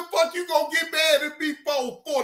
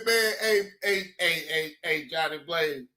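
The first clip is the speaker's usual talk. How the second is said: much lower, pleading imitation; imitating Cam Lo; 10 semitones lower, 5 dB quieter than usual. Imitating Cam Lo pleading with Johnny Blaze. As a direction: plead low like Cam Lo begging. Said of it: "He said".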